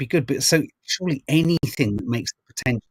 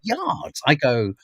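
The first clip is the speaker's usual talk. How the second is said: ordinary talk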